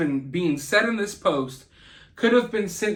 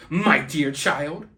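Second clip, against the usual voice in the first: put-on slow, lower, sarcastic "my dear child"; 4 semitones lower, slower than usual. Commentator showing how the mother addressed her kid, sarcastically. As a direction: drag it out with catty condescension. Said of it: "She's saying it sarcastically".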